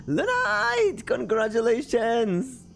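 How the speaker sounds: high, drawn-out cheer; excited congratulations. Real, not acted. Host congratulating Lunite on a debut, excited. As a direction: call out the name high and celebrate.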